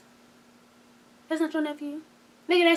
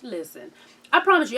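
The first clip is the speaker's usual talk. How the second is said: ordinary talk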